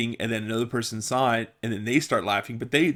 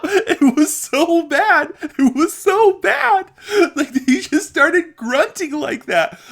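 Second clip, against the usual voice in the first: high, loud speech through helpless laughter; laughing hard; 6 dB louder than usual. Real, not acted. Retelling the treadmill grunter, laughing uncontrollably. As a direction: talk while cracking up. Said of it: "keep a straight face".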